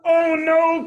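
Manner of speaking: high, loud cry of alarm; cried out. A narrator's reading. Tortoise sees the tree falling, loud alarmed cry. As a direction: cry out in dismay. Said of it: "cried Tortoise".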